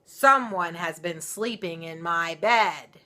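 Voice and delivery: loud, slow booming papa bear; great big voice. Acted. Papa Bear finding his bed slept in, loud and slow. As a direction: boom it out like a huge angry bear. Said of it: "in his great big voice".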